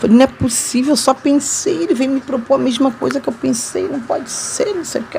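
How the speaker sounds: retelling stunned disbelief, voice climbing and dropping away; amazed disbelief. Retold or imitated. Retold disbelief at his proposal.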